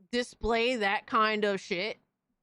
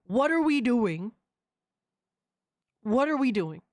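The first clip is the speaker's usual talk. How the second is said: stunned disbelief; 4 semitones higher, 4 dB louder than usual. Retold or imitated. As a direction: shout it like you can't believe your eyes. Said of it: "I'm like".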